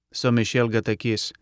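usual speaking voice

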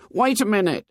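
put-on high boy's voice calling; high-pitched call from the bushes. A narrator's reading. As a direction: call high for someone to wait. Hidden boy calls to another.

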